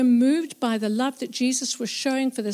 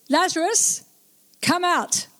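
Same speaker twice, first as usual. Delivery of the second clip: high ringing call into the tomb; loud call; 7 semitones higher than usual. Acted. Jesus summons dead Lazarus from tomb, high ringing call. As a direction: call him out, voice lifted high. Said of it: "called out in a loud voice".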